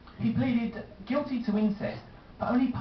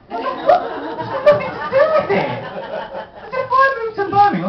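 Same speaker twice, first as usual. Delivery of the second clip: much higher and louder, cracking up mid-protest; laughing protest; 7 dB louder than usual. Real, not acted. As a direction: laugh it out as you object. Comedian protesting amid laughter.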